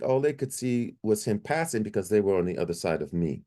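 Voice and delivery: ordinary delivery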